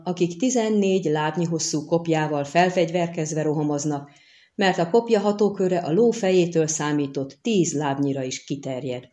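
plain reading voice

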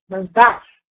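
ordinary talk, nothing marked

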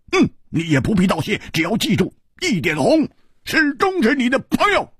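high, emphatic pledge with pauses; slow, deliberate. A narrator's reading. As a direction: declare it in measured pieces, rising. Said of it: "缓缓地说". (缓缓而郑重地高声说)

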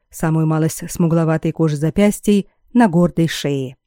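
usual speaking voice

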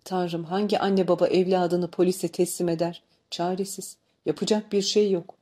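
ordinary delivery

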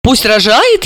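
surprised. A narrator's reading. Guest questioning the upset mother, surprised. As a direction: ask in mild wonder. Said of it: "удивилась".

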